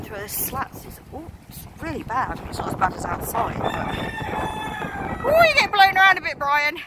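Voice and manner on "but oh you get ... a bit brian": high, fast sing-song to the bird; high cooing to a chicken. Real, not acted. Chatting with a chicken, she coos high. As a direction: coo it to the chicken.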